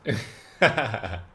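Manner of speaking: short burst of laughter. Real, not acted. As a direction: laugh out loud in disbelief. Laughs at wrong figure.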